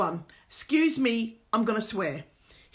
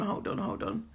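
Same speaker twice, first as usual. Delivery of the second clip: whispered; 6 semitones lower, 7 dB quieter than usual. Real, not acted. About to fetch her man.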